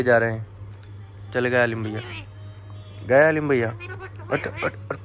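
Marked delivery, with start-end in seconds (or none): 4.3-5.0 high unvoiced clicking at a bird; quick tongue clicks to coax the bird; clicking sound. Real, not acted. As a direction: click the tongue to call a parrot. Clicking noise at parrot.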